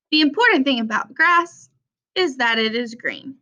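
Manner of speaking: ordinary delivery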